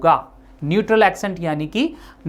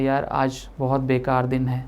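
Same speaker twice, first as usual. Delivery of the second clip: lower, softer, gloomy sigh of a line; sad voice; 5 semitones lower, 6 dB quieter than usual. Shown as a demonstration. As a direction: sound let down by the day. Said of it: "आपकी आवाज़ sad हो जाती है". (उदास आवाज़ में)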